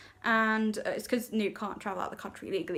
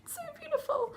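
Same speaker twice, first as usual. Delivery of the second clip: much higher, squealing with delight; swooning gush; 4 dB quieter than usual. Real, not acted. Gushing about a romantic scene.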